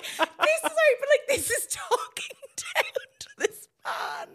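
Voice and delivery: high-pitched, wheezing laugh-talk; helpless laughter. Real, not acted. Host pitying the talked-down-to patient, laughing helplessly. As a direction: squeeze slow words out through real laughter. Host in fits over the role play.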